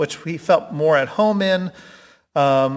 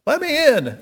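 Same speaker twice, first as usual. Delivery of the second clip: pleading Peter; 13 semitones higher than usual. Retold or imitated. Peter voiced begging at gate.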